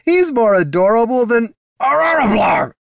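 high, loud cry breaking into a garbled yell; shouted delight cut off by a scream; amused shout turning to yell. Acted. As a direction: gush at a high pitch, then yelp as if attacked. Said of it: "laughed Heraldon".

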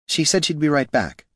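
high, mildly distracted reply; absent-minded. A narrator's reading. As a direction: answer with attention elsewhere. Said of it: "Niln said absently".

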